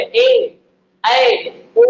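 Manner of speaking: ए, ऐ, ओ each held long and high; long vowel demo. Shown as a demonstration. Teacher listing the long vowels aloud, each one high and held. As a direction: call out ए, ऐ, ओ high and drawn out. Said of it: "जैसे आ".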